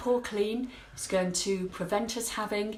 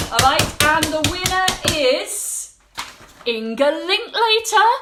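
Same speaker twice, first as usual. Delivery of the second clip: raffle caller; 6 semitones higher, 9 dB louder than usual. Real, not acted. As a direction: drag out the big reveal like a prize host. Announcing giveaway winner.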